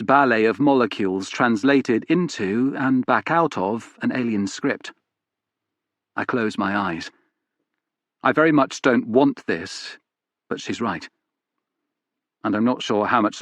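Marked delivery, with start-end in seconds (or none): none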